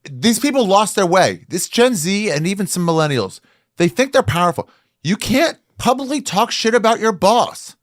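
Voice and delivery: high-pitched, heated tirade; indignant rant. Real, not acted. Host rants about young employees.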